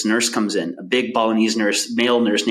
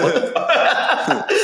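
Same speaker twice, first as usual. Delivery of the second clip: slow, drawn out and laughing; laughing quote; 7 semitones higher, much slower than usual. Retold or imitated. Doctor walking in on them, slow and laughing. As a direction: imitate the doctor's shock through laughter.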